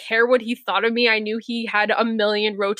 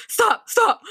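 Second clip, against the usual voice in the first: higher, sharper retold shout of stop stop; mock yell; 10 semitones higher than usual. Examiner shouting at the driver about to hit a car. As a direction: snap it like a panicked examiner. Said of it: "he starts yelling at me".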